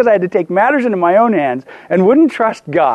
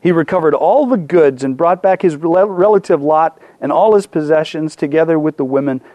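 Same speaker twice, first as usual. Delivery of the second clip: lower, measured Bible reading; scripture reading; 4 semitones lower than usual. Acted. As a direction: read the passage aloud with steady weight. Reads scripture to congregation.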